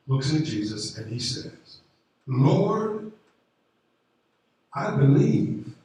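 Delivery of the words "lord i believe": high, louder cry of a desperate father; tearful plea. Acted. Desperate father pleading with Jesus in tears.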